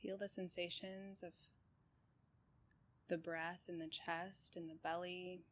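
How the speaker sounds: calm, even meditation guide with long pauses; unhurried body-scan voice, settled and steady; guided meditation. Real, not acted. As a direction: guide them gently through breath and body, leaving space between phrases.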